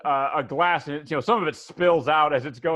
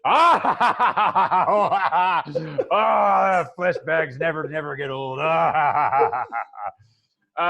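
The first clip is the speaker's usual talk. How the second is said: loud, rolling crew laughter; big put-on belly laugh running through the words; hearty acted guffaw; 8 dB louder than usual. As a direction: roar at your own joke. Skeleton crew roaring at own joke.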